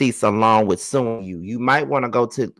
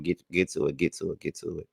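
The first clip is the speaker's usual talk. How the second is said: muttered hurry-up; 6 semitones lower, 11 dB quieter, faster than usual. Real, not acted. Urging herself to skip ahead.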